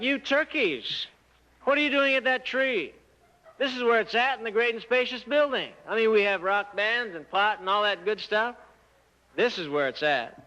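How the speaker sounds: high, taunting jeer at the believers; a sneering crowd calling down to the people at the tree; scoffing mockery. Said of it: "they are scoffing and mocking".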